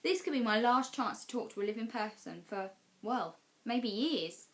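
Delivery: plain reading voice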